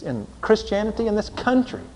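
ordinary talk, nothing marked

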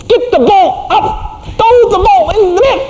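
high, slow mock yell; hammed-up dad yelling at his kid. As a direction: holler at the son from the fence. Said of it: "I'm yelling at my kid".

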